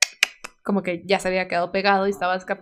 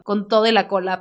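ordinary talk, nothing marked

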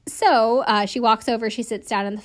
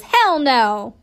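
louder, blunt hell no; emphatic refusal; 3 semitones higher, 8 dB louder than usual. Retold or imitated. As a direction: shut the offer down hard. Inmate flatly refuses braid offer.

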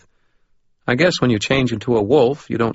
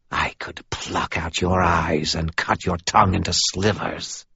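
hissed threat; 2 semitones lower than usual. A narrator's reading. Inhuman caller threatens the narrator in a hissed whisper. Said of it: "hissed the voice".

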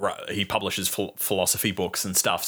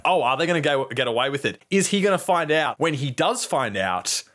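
anxious viewer thoughts; 6 semitones higher than usual. Retold or imitated. Film fan voices a viewer's suspense about the murder, high and rising. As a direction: build mock suspense as the questions pile up. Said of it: "then you think, like".

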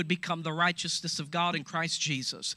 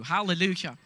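usual speaking voice

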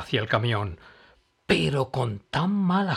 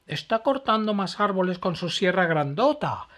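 high, louder panicky cry; alarmed exclaim; 9 semitones higher, 4 dB louder than usual. Acted. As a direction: sound the alarm about the trees. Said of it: "exclamó Noli".

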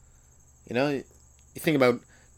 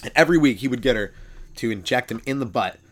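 ordinary delivery

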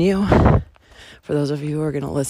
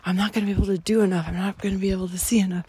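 hurried anxious voice; 2 semitones higher, much faster than usual. Retold or imitated. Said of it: "it was kind of like".

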